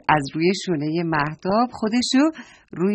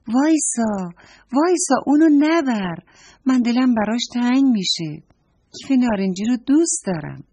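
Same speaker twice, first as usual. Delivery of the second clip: pleading call; 5 semitones higher, 4 dB louder than usual. Acted. Girl begs neighbour not to take bag.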